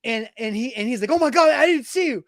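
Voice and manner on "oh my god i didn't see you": high, loud imitation of a shocked apology; startled apology. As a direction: play the mortified accidental culprit. Window washer's startled apology, retold.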